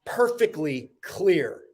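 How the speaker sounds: slow, emphatic spoken-word delivery, high attacks falling away; dramatic poem recital. Acted. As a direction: declaim each line, pausing before the last words. Recites own motivational poem.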